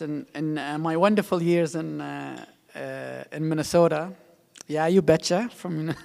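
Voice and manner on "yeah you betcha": put-on Minnesotan accent. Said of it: "sometimes a Minnesotan accent".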